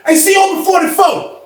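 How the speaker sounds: high, carrying holler; retold yell to open the door; calling out. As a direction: shout for the guard from a distance. Repeats the call to the guard.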